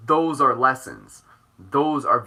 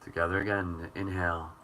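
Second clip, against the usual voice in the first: calm guide fading to a hush; guided breathing; 6 semitones lower, 6 dB quieter than usual. Acted. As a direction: lead them gently through each breath. Leads a breathing practice.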